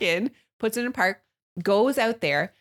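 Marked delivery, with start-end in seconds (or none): none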